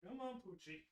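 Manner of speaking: calling the dog from off-mic, faint and distant. Real, not acted. Streamer calling his dog from the next room, distant. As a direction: far off, calling a pet into the room.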